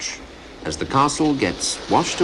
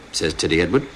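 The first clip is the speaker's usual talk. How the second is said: ordinary delivery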